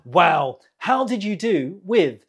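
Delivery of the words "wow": ordinary talk, nothing marked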